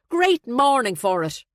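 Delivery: loud, high, cheery remark; breezy, bright small talk. A narrator's reading. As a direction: chirp it brightly to break the tension. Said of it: "Maeve said brightly".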